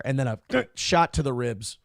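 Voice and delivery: ordinary talk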